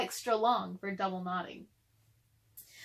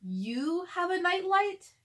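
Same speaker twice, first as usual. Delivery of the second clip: higher, slow, wondering; amazed question; 8 semitones higher, 4 dB louder, slower than usual. Acted. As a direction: ask it wide-eyed and slow. Chester amazed at nightlight.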